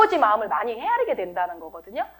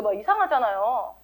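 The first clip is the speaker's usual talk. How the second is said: ordinary talk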